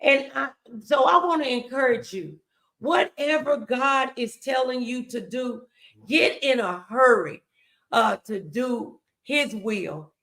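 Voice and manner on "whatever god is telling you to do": normal talking voice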